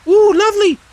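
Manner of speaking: very high, loud squeal of joy; delighted Roo. Acted. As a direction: squeal it out, thrilled. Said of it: "at last he stopped and said".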